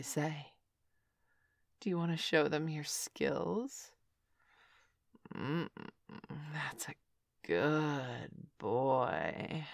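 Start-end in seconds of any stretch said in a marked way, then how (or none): 5.3-9.6 low, soft approving purr; soft praise. Acted. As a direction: praise him quietly and slowly. Praising him softly.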